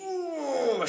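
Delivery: high straining ooh; effort grunt. A narrator's reading. Old lady shoving the pig out, straining ooh.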